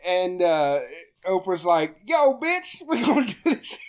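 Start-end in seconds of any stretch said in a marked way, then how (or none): 2.1-3.8 high put-on sassy voice; hammed-up urban talk, pitched high; urban-style Oprah. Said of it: "Why are you talking like this?".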